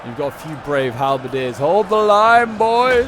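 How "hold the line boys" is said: rallying battle cry, much higher and louder; civil war soldier. Acted. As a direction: roar it to your men on the line. Rallying troops in battle commentary.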